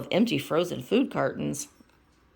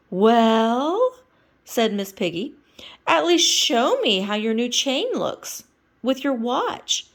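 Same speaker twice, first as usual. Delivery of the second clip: Miss Piggy voice; 6 semitones higher, 5 dB louder, slower than usual. Acted.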